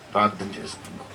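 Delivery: normal talking voice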